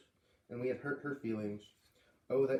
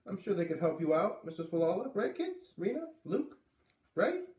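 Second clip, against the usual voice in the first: high, pushy, nervous rising asks; pleading dad; 6 semitones higher than usual. Acted. Dad pressing his kids to agree, high and nervous. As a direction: cajole the kids with a forced high cheer.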